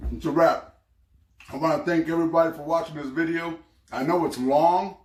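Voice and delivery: talking while chewing; words round a mouthful of food; mouth full. Real, not acted. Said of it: "Excuse me, I'm still chewing".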